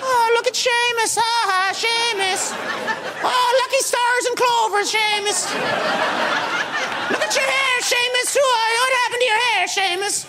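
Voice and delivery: high, taunting sing-song mockery; mocking Conan voice. Conan taunting Sheamus on the street in a high mocking voice. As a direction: tease him in a high mocking voice. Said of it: "I'll do the voice. I'll be taunting you. I'll be Conan O'Brien".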